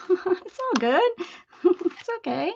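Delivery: high, laughing reassurance. Real, not acted. Telling a sorry viewer it's fine, laughing. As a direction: chuckle it off kindly.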